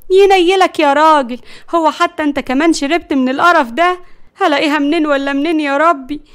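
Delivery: high, loud dismay; exasperated scolding. Acted. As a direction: despair at a foolish husband. Wife scolds her drugged husband.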